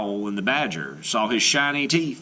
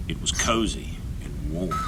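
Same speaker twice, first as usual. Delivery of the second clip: whisper; 7 semitones lower, 5 dB quieter than usual. A narrator's reading. Narrating the mole's cozy nest.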